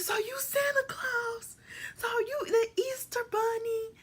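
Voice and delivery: put-on very high, slow disbelieving kid; mock stunned child; shocked realisation. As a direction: stretch out the shock.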